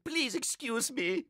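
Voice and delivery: high, embarrassed fussy man; fussy principal, high and flustered. A narrator's reading. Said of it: "he cried".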